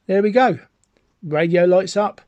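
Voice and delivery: ordinary delivery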